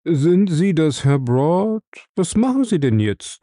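butter him up sweetly on the phone; sweet flattering tone. A narrator's reading. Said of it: "in jenem schmeichelnd süßen Ton".

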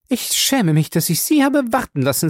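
high, eager chatterbox voice; a fussy, excited lady gushing at her guest; gushing Mrs Baker. A narrator's reading. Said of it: "rief sie".

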